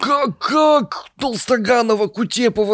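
high, incredulous squeal of disbelief; shocked disbelief. Real, not acted. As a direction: act scandalised that she doesn't know them. Mock disbelief at colleague's ignorance.

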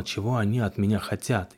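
ordinary delivery, nothing marked

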